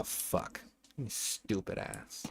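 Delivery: slow whispered insult under the breath; whispered curse. Real, not acted. Streamer muttering at his own mistake, whispered. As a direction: hiss it to yourself.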